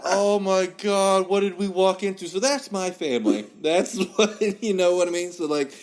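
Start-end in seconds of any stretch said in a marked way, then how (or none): 0.0-2.3 higher, mock stunned reaction; retold dismay. Recalling the family funeral chaos, mock dismay. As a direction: replay your appalled disbelief.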